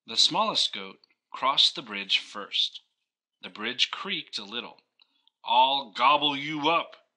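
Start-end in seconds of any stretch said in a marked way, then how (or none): 5.4-7.1 loud, higher growling troll threat; mean troll voice. Acted. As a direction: bellow it like a big mean troll. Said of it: "cried the troll".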